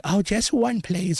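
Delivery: put-on high pleading kid voice; mock trick-or-treater. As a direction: imitate a child asking for one candy. Imitates meek trick-or-treaters.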